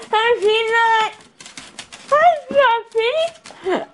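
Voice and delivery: high, cooing greeting to a dog. Real, not acted. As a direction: coo at the puppy. Baby talk to pets.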